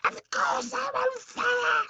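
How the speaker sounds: very high, slow, shrieking crone; old hag yelling. Acted. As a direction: yell it back like an outraged old witch. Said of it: "she yelled".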